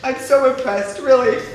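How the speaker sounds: fighting back tears with a laugh; tearful laugh. Real, not acted. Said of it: "I don't wanna cry".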